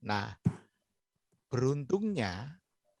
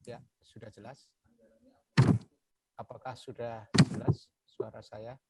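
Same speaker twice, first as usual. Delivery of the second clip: far from mic. Real, not acted. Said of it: "Eh, agak pelan ya, Pak".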